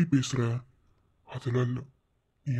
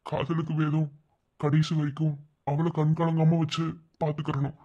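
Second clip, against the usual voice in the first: ordinary talk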